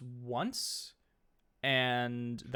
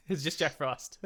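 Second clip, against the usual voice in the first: laughing. Real, not acted. Laughing over the Jack Frost joke.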